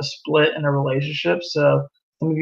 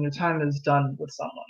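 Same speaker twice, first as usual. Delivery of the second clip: repeated much softer and lower; softer echo; 4 semitones lower, 9 dB quieter than usual. Shown as a demonstration. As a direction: let the repeat sink in quietly. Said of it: "when your time is done with someone".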